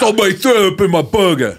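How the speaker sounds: shouted English-accented complaint. Shown as a demonstration. Coach shows student a burger complaint, shouted English accent. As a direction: bellow it in a put-on English accent.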